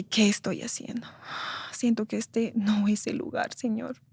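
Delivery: súplica muy baja, casi susurrada; llanto contenido al recordar. Retold or imitated. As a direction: recuerda su angustia en voz queda y lenta. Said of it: "Salí de clases ese día llorando". (lamento en voz baja)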